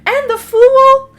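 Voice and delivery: high, loud, slow drawn-out wow; amazed wow. Real, not acted. Reader marvelling aloud at the Fool card.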